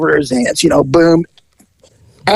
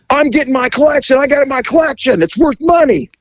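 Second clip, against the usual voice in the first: high, rushed mock protest; indignant insistence; 8 semitones higher, faster than usual. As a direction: imitate him fussing over his precious collection. Retold man defending his collection.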